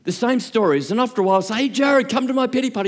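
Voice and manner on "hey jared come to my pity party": much higher, bright calling invite. Retold or imitated. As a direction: sing it out to your mate.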